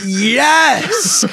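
excited yes. Retold or imitated. Imagined excitement at Hey y'all.

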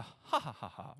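quiet forced ha ha, fading off; mock the dutiful social laugh; fake polite laugh. Shown as a demonstration. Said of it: "like the social cue laugh".

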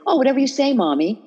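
high, syrupy "whatever you say, Mommy"; sweet placating. Retold or imitated. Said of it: "talk really sweetly".

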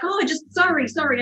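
high-pitched, halting imitated apology; flustered apology. Couch buyer caught staring at her house, flustered apology. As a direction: stumble over a hasty retold apology.

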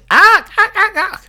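very high yelping howl; dog howl. Acted. Dog in the poem howls at its choke chain, high yelps.

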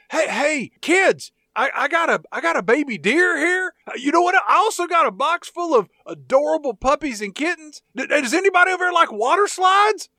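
high, loud, syrupy folksy stranger voice; folksy friendly stranger. Acted. A lurking voice luring the blindfolded family, high sugary folksy charm. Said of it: "the voice says".

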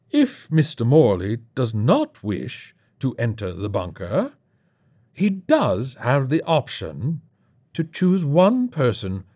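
slow, theatrical delivery with swooping pitch; suave menacing host. Acted. Host offers a deadly alternative, slow and grand. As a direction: savour each word of the sinister offer.

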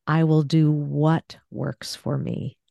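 "what" punched, slow deliberate phrase; stress on WHAT. Shown as a demonstration. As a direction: slowly put the weight on "what". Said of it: "let me, uh, do one of these".

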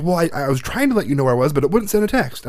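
put-on whiny teen excuse. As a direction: play the defensive teenage son. Voicing son's excuse again.

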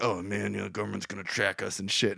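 low, soft mock conspiracy theorist; a hint of a paranoid stoner muttering; lightly voiced paranoid guy. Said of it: "he's like".

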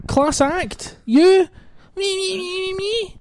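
high whiny self-pitying voice; whiny emo voice. Pretend. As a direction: whine it out, way up high. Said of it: "My whiny emo voice".

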